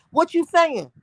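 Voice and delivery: usual speaking voice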